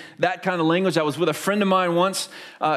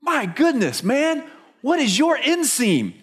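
higher, slow, wide-eyed lady's voice; a stranger marvels at a giant football player; amazed lady; 7 semitones higher, slower than usual. Retold or imitated. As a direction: gawk up at the huge man in wonder. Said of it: "and she goes".

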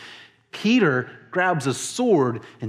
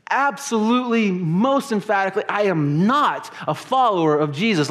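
acted adamant denial, starting high; emphatic denial; 6 semitones higher than usual. Peter answering his accuser a third time, emphatic denial. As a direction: deny it forcefully, hammering the key words. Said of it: "the language gets stronger and stronger".

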